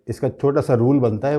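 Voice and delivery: ordinary delivery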